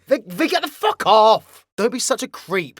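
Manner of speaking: loud, exasperated shove-off; annoyed protest. Acted. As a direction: fend off a clingy pest. Creator fends off his character's hug.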